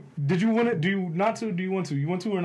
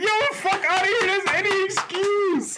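much higher, laughing disbelief; incredulous; 13 semitones higher than usual. Retold or imitated. Mocking his passenger's excuse, high and incredulous. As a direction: yelp it in mock outrage. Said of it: "I was like".

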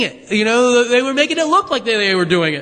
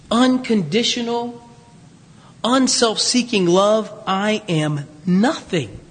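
slow solemn reading; slower than usual. Acted. Slow emphatic scripture.

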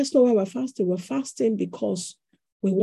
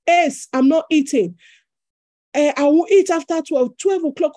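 firm refusal; 6 semitones higher, 7 dB louder than usual. Retold or imitated. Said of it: "I say".

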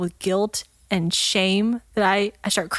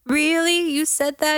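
surprised; 7 semitones higher, slower than usual. Retold or imitated. Shocked kid hearing mom's reply.

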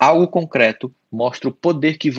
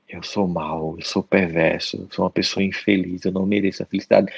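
low, subdued acted self-blame; self-condemnation; 6 semitones lower, 4 dB quieter than usual. Psychologist voices someone fixed on a bad thought, low self-blame. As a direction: sink into guilty inner talk, soft and deep.

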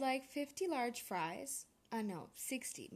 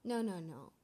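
ordinary talk, nothing marked

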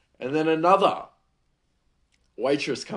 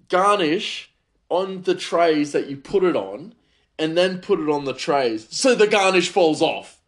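loud, raised pitch, hammering each step; angry rant; 5 semitones higher, 6 dB louder than usual. Real, not acted. Chef venting about a colleague's garnishing, angry. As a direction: let real exasperation push the voice up and louder. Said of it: "I didn't mean to get so angry".

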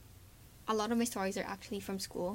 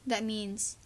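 ordinary delivery

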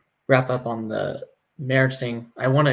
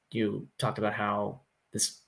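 normal talking voice

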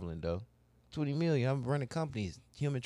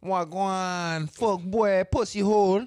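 put-on higher, louder Jamaican accent; mock Jamaican patois; 5 semitones higher, 4 dB louder, much slower than usual. Friend tries out his chosen language, mock Jamaican accent. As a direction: call it out brightly in fake Jamaican, pitched up.

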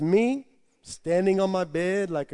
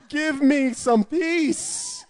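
laughing; 11 semitones higher, 5 dB louder, much slower than usual. Retold or imitated. Overjoyed, mouth running away.

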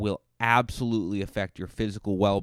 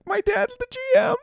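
very high whiny sob; mock crying; 6 dB louder than usual. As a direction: whine it through fake tears. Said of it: "And I'd be like".

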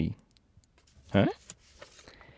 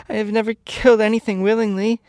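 high, louder acted sob of a little girl; sobbing; 12 semitones higher, 5 dB louder than usual. A narrator's reading. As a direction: protest tearfully, pitched far up. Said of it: "she sobbed".

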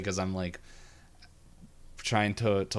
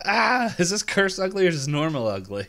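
mock startled cry; 10 semitones higher, 7 dB louder than usual. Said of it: "he looks in the mirror".

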